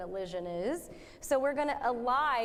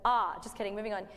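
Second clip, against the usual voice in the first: normal talking voice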